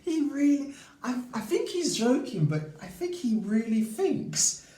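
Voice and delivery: high squeaky giggle breaking through the words; can barely get it out for laughing. Real, not acted. Giggling over neighbour's dog accusation.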